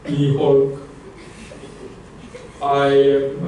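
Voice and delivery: low, halting monster voice attempting British; lightly voiced big monster, badly faked British accent; Hulk. Speaker imagining a monster talking British, halting mock role voice. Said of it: "imagine the Hulk was talking with a British accent".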